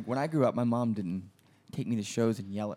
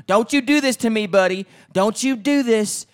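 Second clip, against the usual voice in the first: much higher, much louder mock screaming mom; angry mother screaming; 10 semitones higher, 11 dB louder than usual. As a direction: shriek it like a furious mother in a parking lot. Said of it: "cussing at me and screaming".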